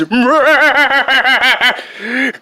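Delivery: high loud villain cackle; villain laughing at his foe; evil laugh. Acted. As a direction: cackle triumphantly. Villain laugh after vow.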